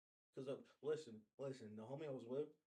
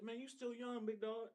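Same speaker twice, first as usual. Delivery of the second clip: high mock flattery; 10 semitones higher than usual. Voicing herself to older guy.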